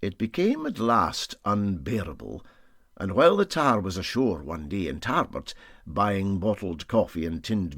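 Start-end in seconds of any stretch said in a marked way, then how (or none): none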